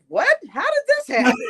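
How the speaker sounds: high, loud mock gasp of disbelief; mock astonished onlookers. Imitating onlookers shocked by the couple. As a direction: play the gawking stranger, high and loud. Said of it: "look at both of us and went".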